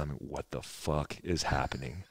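put-on boy murmuring to his cousin on the floor; hushed. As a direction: whisper it to your cousin on the floor. Lying face to face with cousin.

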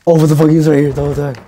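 real shock at a hidden enemy; yelling in disbelief; startled yell. Real, not acted.

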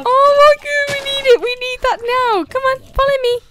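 high, eager excited voice. Real, not acted. Coaxing a cute animal to follow, eager and excited. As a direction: gush over the new find. Excited about cow rabbit.

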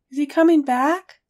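high, loud hopeful question. A narrator's reading. Harry hears the motor again, hopeful. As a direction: ask it with sudden hope. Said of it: "Harry asked hopefully".

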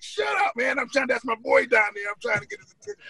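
high-pitched retold shout at the fans; yelling at hecklers. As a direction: snap at the crowd around you. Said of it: "I'm yelling everything".

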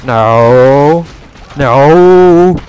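losing gamer's despair, shouted; desperate shouting. Real, not acted. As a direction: shout as the base falls. Losing the base.

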